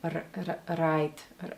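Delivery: "right" with a light, soft tapped r; soft Russian R. Retold or imitated. Coach copying the actress's subtle sound, soft R. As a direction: tap the "r" gently and quietly. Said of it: "her soft R's".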